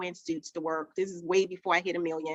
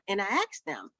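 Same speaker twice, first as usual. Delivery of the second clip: ordinary talk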